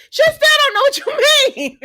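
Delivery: very high, loud, laughing exasperation; exasperated squeal. Retold or imitated. Recapper retelling her reply to the riddles, exasperated high squeal.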